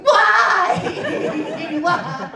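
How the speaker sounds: hammed-up desperate cry; mock wail. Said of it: "holding my ass going".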